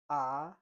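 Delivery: Mandarin second tone on AH, sliding upward. Shown as a demonstration. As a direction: let AH climb like a question. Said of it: "The second one is".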